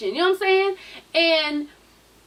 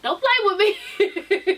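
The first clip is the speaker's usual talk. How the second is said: laughing warning; 6 semitones higher, much slower than usual. Pretend. Mock threat, laughing.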